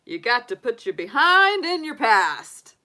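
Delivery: loud, high, hammed-up cartoon line; playful, swooping movie impression; Lion King warthog quote. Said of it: "think of the Lion King".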